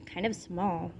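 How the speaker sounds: grumbling to herself, quietly; muttered. A narrator's reading. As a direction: mutter it under the breath. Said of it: "she muttered".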